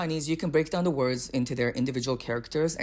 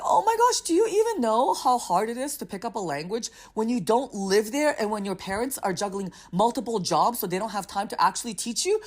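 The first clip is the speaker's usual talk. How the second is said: exasperated rant; 7 semitones higher than usual. Retold or imitated. Defending her Chinese to a rude driver, exasperated. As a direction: vent the unfairness in one breath. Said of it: "And I'm like".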